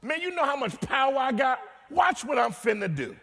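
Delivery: lightly voiced Jesus, high and bold; voicing Jesus. Pastor voicing Jesus boasting of power, a hint of a shout. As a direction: play Jesus teasing the disciples, loud. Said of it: "Jesus is like".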